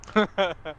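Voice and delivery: loud burst of laughter; laughing. Real, not acted. Laugh on its own line.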